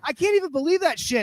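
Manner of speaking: indignant rant. Acted. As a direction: keep the outrage up as the volume settles. Continued rant at the guy.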